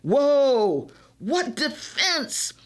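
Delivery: an amazed exclamation, slowed down. A narrator's reading. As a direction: marvel at the steal. Teammate amazed at play.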